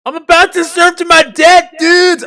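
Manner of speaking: goofy California surfer voice; surfer dude accent. Pretend. Friends watching a movie, mock surfer dude accent. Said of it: "I gotta work on that accent".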